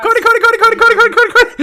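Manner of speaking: high, loud rapid-fire mock calls; imitated repeated shouting. Game master asking did you keep shouting, mock frantic yelling. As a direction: holler the name over and over without a break. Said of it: "Did you keep shouting?".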